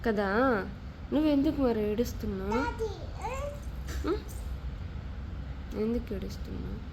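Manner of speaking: very soft, low voice; hushed, tender questioning; gentle soothing. Real, not acted. Gently questioning a crying child.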